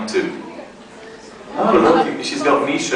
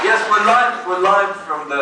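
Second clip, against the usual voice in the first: showy caller; 7 semitones higher than usual. Acted. Prank phone call persona.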